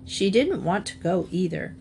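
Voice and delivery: usual speaking voice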